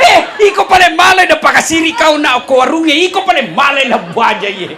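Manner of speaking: laughing, loud mock scolding; hammed-up shout at the wife; husband yells at his wife for taking money, laughing; mock angry husband.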